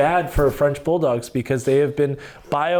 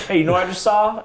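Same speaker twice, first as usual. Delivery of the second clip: high-pitched Kentucky twang; eager mock-Kentucky call-out; Kentucky accent; 9 semitones higher, 4 dB louder, faster than usual. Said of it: "I throw in, like, a Kentucky accent".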